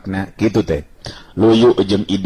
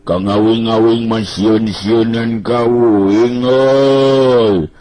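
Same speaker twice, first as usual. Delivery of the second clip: put-on high, slow, drawn-out voice of a tired young fellow; Banteng Bulung voice; 5 semitones higher, slower than usual. Banteng Bulung grumbles to Rawing about hunger, high and drawn-out. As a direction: stretch the words out high and slow, complaining. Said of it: "Si Banteng Bulung ngareret ka si Rawing bari nyarita".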